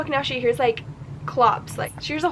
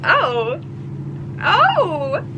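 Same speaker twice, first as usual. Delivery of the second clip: flustered squeal; 6 semitones higher, 6 dB louder than usual. Retold or imitated. Flustered at the neck-licking scene.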